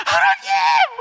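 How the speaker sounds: a huge, high shout of anguish; crying out to God. Retold or imitated. Young minister crying out to God in despair, loud high shout. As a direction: wail it up to heaven. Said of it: "막 이러고".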